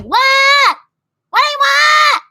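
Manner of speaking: high, loud bratty yell; rude kid yelling. Shown as a demonstration. Child yelling back at parent who called, bratty high shout. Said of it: "I don't want you to be like".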